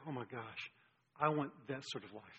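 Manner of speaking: low, softly imagined reaction; hushed longing. Retold or imitated. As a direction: say it low, like a listener quietly wishing. Voices listeners' longing on hearing David.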